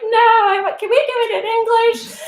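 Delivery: put-on high pleading kid voice; whiny student. Teacher mimicking students begging for English. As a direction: beg in a high whine. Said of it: "some of them might be like".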